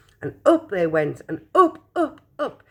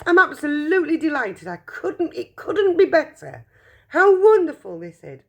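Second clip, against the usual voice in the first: higher and rising in glee, building louder; delighted cry; 10 semitones higher, 4 dB louder than usual. Acted. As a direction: gush with joy over Charlie's win. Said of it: "he cried".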